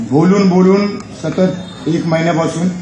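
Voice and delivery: a bit hoarse from talking; real tired voice, worn from a month of talking; slightly hoarse voice. Real, not acted. Comedian apologises to audience for his worn voice, slightly hoarse. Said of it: "थोडासा आवाज बसलाय माझा".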